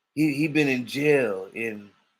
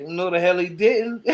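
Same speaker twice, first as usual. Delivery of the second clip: indignant denial; 6 semitones higher, 4 dB louder than usual. Retold or imitated. Getting mad at the claim.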